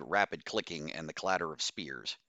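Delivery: usual speaking voice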